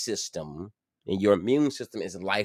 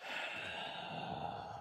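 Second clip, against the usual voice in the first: weary sigh; 16 dB quieter than usual. Real, not acted. Tired after bill worry.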